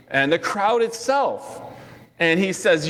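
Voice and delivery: ordinary delivery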